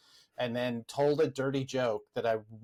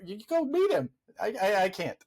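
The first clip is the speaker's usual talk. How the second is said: higher-pitched, flustered imitation of a nervous fan; starstruck nerves; 8 semitones higher than usual. Starstruck friend too nervous to meet her idol, imitated fluster. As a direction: stammer it out like an overexcited fan.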